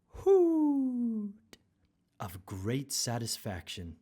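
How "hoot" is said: drawn-out high hoot; slow, high joyful hoot; big hoot. Acted. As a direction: stretch the word into a happy hoot. Narrator acts out hoot.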